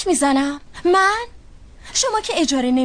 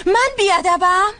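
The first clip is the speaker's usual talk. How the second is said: indignant disbelief; 5 semitones higher, 3 dB louder, slower than usual. Acted. Indignant retort in quarrel.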